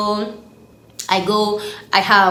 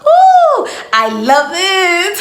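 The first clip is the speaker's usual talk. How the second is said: high squealing ooh, then a slow laughing I love it; excited squeal; 11 semitones higher, 8 dB louder, much slower than usual. Real, not acted. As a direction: gush with delight. Thrilled about the video topic.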